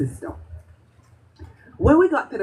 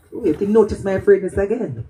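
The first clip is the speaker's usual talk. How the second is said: ordinary delivery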